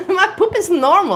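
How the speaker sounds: high, laughing through the words. Real, not acted. Telling viewers about her poop, laughing. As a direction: giggle it out, tickled.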